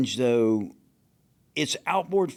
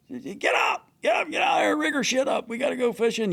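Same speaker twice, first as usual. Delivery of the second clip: roust the crew out of bed; hammed-up captain yelling get up; mock shouting wake-up; 11 semitones higher than usual. Captain banging on crew door.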